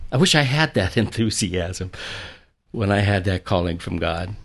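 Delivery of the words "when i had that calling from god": ordinary delivery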